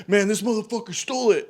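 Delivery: high, loud accusing; angry accusation. Retold or imitated. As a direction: point the finger, furious. Teddy accusing the kid.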